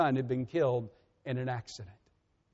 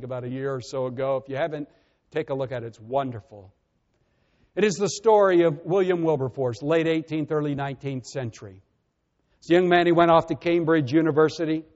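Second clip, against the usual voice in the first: ordinary talk, nothing marked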